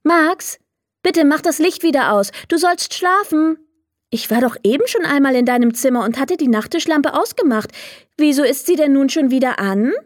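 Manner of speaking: a mum telling her son to sleep; mother. Acted. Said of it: "eine vertraute Stimme".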